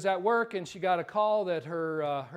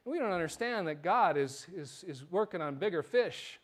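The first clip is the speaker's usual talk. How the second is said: ordinary delivery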